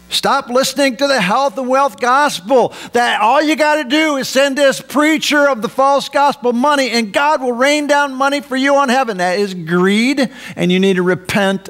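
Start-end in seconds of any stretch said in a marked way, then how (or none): 0.1-9.2 high-pitched pulpit denunciation; insistent, raised sermon voice; forceful preaching. Real, not acted. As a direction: preach against it, pitched up and driving. Denounces health and wealth gospel.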